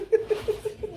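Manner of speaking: high burst of laughter. Real, not acted. Laughing after pidgin practice.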